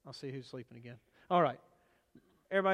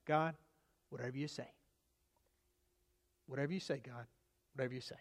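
much softer, lower, sincere; quiet surrender; 5 semitones lower, 9 dB quieter, faster than usual. Real, not acted. The preacher prays quietly to God in soft surrender. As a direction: hand it over to God, softly.

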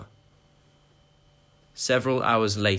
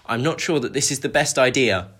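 anxious plea; 4 semitones higher, 6 dB louder than usual. Acted. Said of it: "His cries fell on deaf ears".

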